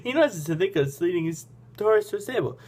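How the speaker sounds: usual speaking voice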